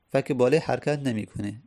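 usual speaking voice

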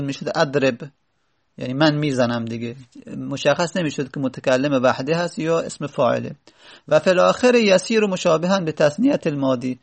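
normal talking voice

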